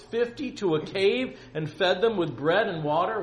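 loud, high, aggrieved pleading; impassioned, declaimed protest; dramatic scripture reading. Acted. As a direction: read it as a servant begging for his life.

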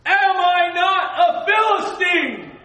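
huge slow high bellow across the valley; Goliath's shout. Retold or imitated. Guide acting out Goliath taunting the Israelites, booming slow shout. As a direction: roar it out like a giant taunting an army. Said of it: "he can start to hear".

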